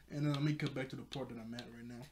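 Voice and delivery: normal talking voice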